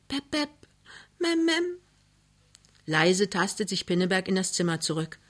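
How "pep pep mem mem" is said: put-on very high toddler babble; small child calling. A narrator's reading. Toddler in the bedroom calls for parents, high babble. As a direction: pipe up like a little child. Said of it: "Drinnen ruft der Murkel".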